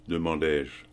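usual speaking voice

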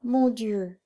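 understated grieving plea; moaning lament. A narrator's reading. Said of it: "gémit la pauvre femme".

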